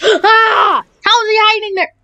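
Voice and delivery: gasp, then high-pitched disbelief; startled outburst. Real, not acted. Gamer ambushed by a hidden player, startled outburst.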